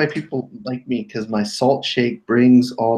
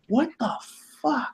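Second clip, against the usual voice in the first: surprised; 13 semitones higher, 7 dB quieter than usual. Real, not acted. Shocked at found item.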